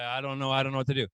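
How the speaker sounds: low, fast, dopey mumble; dazed after a bath. Acted. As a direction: sound melted and useless. Dazed person after hot bath.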